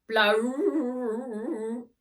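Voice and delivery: loud high honk like a car horn; rattly bagpipe honk; rattling pipes. Acted. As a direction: blast it like a rickety horn. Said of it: "rattled the pipes".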